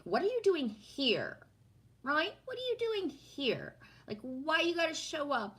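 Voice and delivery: high-pitched indignant questioning of an intruder; mock insulted. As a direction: scold an unwelcome spider, pitched high. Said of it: "Sometimes I still get, like, insulted".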